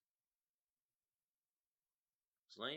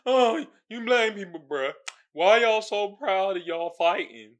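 loud, higher mocking voice; hammed-up complaint; mock complainer; 6 semitones higher, 8 dB louder than usual. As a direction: mimic a whining critic, pushed louder. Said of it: "keep complaining about".

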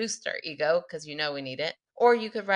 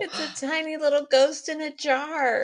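cooing; 10 semitones higher than usual. Real, not acted. Cooing over a gift.

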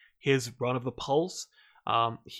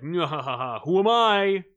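gloating villain; 4 semitones higher, 6 dB louder than usual. Retold or imitated. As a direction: cackle and tease like a smug bad guy. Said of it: "who's like".

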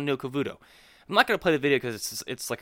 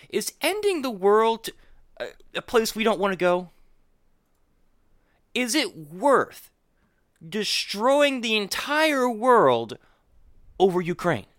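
slow incredulous; 5 semitones higher than usual. Real, not acted. Incredulous rant about nuclear war.

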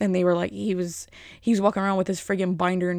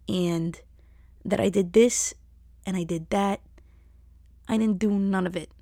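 imitated reading aloud; slower than usual. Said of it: "she's reading off a paper".

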